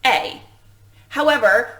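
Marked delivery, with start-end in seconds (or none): 0.0-0.4 the long vowel "A" said alone, like the letter name; vowel sound demo. Shown as a demonstration. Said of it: "only one vowel sound".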